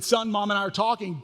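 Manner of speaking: calling back to a son in the next room. Retold or imitated. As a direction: call back to the other room. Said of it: "And I said".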